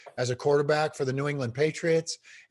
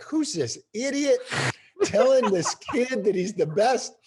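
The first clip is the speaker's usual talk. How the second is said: mocking parents; 6 semitones higher than usual. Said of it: "the rest of the parents are like".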